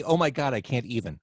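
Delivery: ordinary delivery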